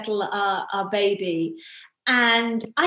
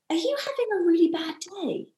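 softer, higher-pitched gentle question; a soft, caring check-in; compassionate; 6 semitones higher, 4 dB quieter than usual. Retold or imitated. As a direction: ask it with genuine empathy, no sarcasm. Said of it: "I didn't say it sarcastic".

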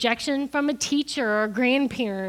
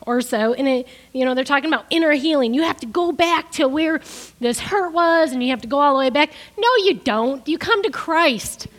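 loud, higher, driving sermon delivery; preaching at full force; 3 semitones higher, 6 dB louder than usual. Acted. Preacher rejecting the inner healing teaching, loud and forceful. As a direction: preach it, push back against the teaching.

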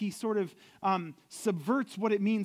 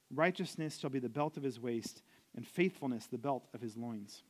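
measured, solemn Bible reading, a little lower and softer; scripture reading; 4 semitones lower, 4 dB quieter than usual. Acted. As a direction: read the verse like a lectern reading. Reads Isaiah eleven aloud.

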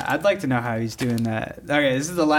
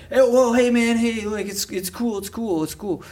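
nervous stranger; 8 semitones higher than usual. Retold or imitated. Stranger placating in retold scene.

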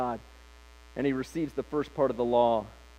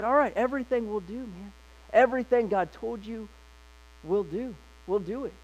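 eager pledge; 6 semitones higher than usual. Retold or imitated. People pledging eager obedience.

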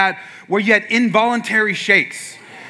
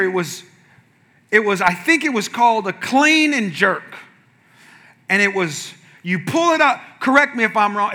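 ordinary talk, nothing marked

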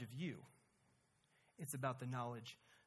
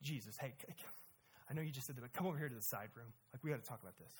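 hushed aside; 7 semitones lower, 9 dB quieter, much faster than usual. Retold or imitated. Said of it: "he goes".

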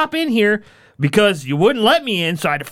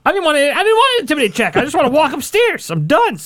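exasperated protest; 8 semitones higher than usual. Real, not acted. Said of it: "protesting the check".

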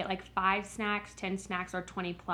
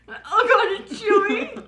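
real laughing squeal, much higher and louder; laughing disgust; 11 semitones higher, 9 dB louder, slower than usual. Real, not acted. Chewing the fish stick and laughing in disgust. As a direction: giggle the words out in shock.

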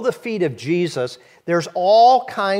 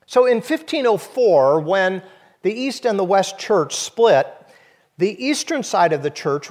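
normal talking voice

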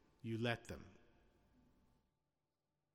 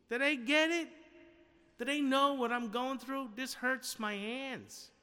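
high-pitched, hammed-up martyr's whine; mock self-pitying complaint; 8 semitones higher than usual. Preacher imagining Jesus complaining on the cross, mock self-pity. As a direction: complain as a put-upon martyr, pitched well up.

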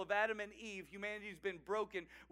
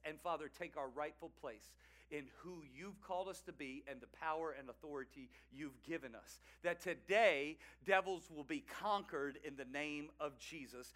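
low, earnest public prayer; subdued prayer, swelling on key words; leading prayer; 5 semitones lower than usual. Real, not acted. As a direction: lead the congregation in prayer, pitched down. Leads the congregation in prayer.

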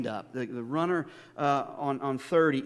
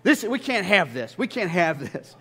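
louder, fast, fussy protest; indignant objection; 3 semitones higher, 7 dB louder than usual. Retold or imitated. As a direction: play the upset elder's wife. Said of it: "She says".

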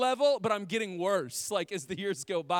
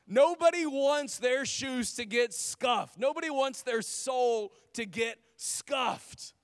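ordinary talk, nothing marked

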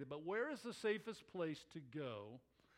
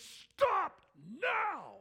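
much higher, much louder command; furious scoutmaster yell; 13 semitones higher, 9 dB louder than usual. Retold or imitated. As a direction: scream it to stop a boy walking toward a bear. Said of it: "he goes".

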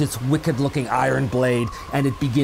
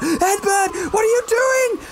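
desperate call; slower than usual. Acted. Sherilyn calling to Edbert for help, high shout. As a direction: cry out as you're losing the fight. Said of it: "She calls out".

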